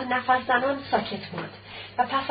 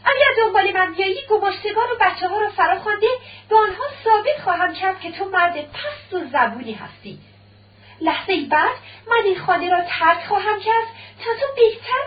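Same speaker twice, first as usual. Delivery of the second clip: shouting; 6 semitones higher than usual. Acted. Wife threatening her husband as she leaves, shouting angrily. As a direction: cry out to drive him away. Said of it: "داریا فریاد زد".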